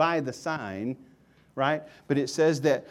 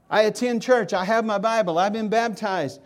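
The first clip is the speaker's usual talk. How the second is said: higher, louder, self-satisfied churchgoer voice; smug churchgoer; 8 semitones higher, 7 dB louder than usual. Pretend. Preacher voicing a nominal churchgoer to congregation, boastful and louder. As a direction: boast like someone sure church attendance saves.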